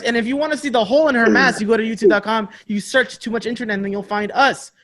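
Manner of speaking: usual speaking voice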